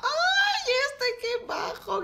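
high, laughing squeal; delighted squeal. Real, not acted. Gamer squealing at the shy Sim. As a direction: squeal at how sweet it is.

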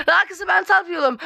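ordinary talk

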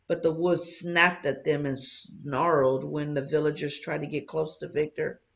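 plain reading voice

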